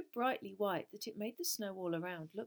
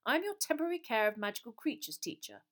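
brisk teacher; 4 semitones higher, 6 dB louder than usual. A narrator's reading. Grubbly Plank introduces herself.

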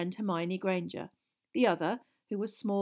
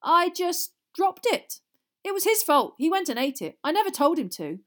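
high, loud self-defence; protesting innocence; 10 semitones higher, 8 dB louder than usual. A narrator's reading. Son defends himself to his angry father, protesting innocence. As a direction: plead innocent with pitch raised.

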